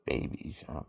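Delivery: usual speaking voice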